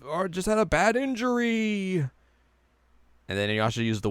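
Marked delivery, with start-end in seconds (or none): 0.1-2.0 high-pitched, hammed-up declaration; mock villain accusation. Villain addressing Moroku in the recap, mock accusation. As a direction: draw it out in a high put-on voice. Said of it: "And he's like".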